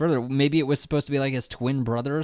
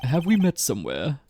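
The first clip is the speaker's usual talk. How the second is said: snooty voice. Acted. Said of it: "snooty voice".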